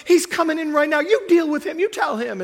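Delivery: high, loud insistent wife voice; worked-up, high-pitched retold plea; imitated urgent wife. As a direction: play a wife urgently passing the job on. Acting out wife handing over husband.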